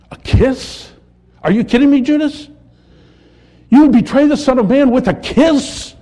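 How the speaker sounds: high, loud disbelief with stress on KISS; can't-believe-it rebuke, pitched high and forceful; Jesus' incredulous rebuke. Acted. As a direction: rebuke a betrayer in shocked disbelief. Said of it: "That's the point of Jesus' rebuke".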